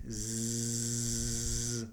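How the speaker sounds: "Z" as a long buzzing zzz; voiced consonant demonstration. Shown as a demonstration. Teacher shows sounds that vibrate, held voiced consonant. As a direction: sustain "Z" as a bare buzz. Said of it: "all of these will vibrate".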